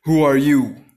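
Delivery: loud, challenging cry. A narrator's reading. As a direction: call out sharply at full voice. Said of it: "and cried out".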